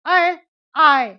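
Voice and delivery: अय and आय drawn out slowly, one by one; slow sound drill. Shown as a demonstration. Teacher to students, drilling the syllables slowly. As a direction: stretch अय, आय for the class. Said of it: "शब्द को हम उच्चारित करेंगे".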